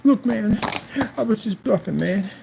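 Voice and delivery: high pleading voice mocking the seller; put-on whimpering voice, high and pleading; mocking whiny plea. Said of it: "sit there and go".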